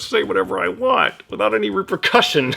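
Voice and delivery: gleeful, high evil cackle; unhinged villain. Acted. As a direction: let the evil laughter slip out. Villain lets slip evil glee.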